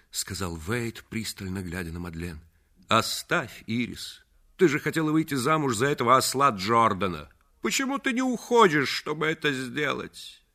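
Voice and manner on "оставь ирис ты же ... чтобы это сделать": громче и быстрее, с издёвкой; бросает жене насмешливый упрёк; грубый упрёк. A narrator's reading. Муж грубо отталкивает жену. (муж отталкивает Ирис, резко и насмешливо)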